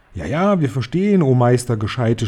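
ordinary delivery, nothing marked

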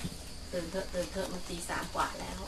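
slow, hushed wake-up murmur; soft whisper. Retold or imitated. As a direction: gently hush him awake. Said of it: "เขากระซิบเบาๆ". (กระซิบเบาๆ ช้าๆ ปลุกให้ตื่น)

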